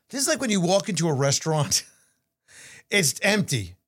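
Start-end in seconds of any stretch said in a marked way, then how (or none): none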